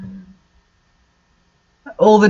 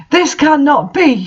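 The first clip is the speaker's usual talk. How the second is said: higher, hammed-up angry cry; mock angry shout; 7 semitones higher than usual. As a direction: play the outraged official, pitched way up. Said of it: "she shouted angrily".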